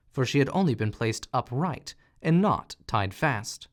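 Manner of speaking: ordinary delivery, nothing marked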